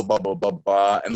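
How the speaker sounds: ordinary talk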